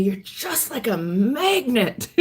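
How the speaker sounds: slow, drawn-out quote leaning on magnet; retold amazement. Boyfriend's dad telling her what she is like, marvelling slowly. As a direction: marvel slowly, stretching the words out. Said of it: "Tom's dad said to me".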